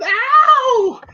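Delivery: high-pitched, drawn-out yelp; imitated high wail of distress; Adrian's mock cry. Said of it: "Adrian is going".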